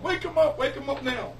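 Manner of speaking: high, urgent mock yell; retold yelling. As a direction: shout an urgent order, imitating a crowd. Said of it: "we're all yelling".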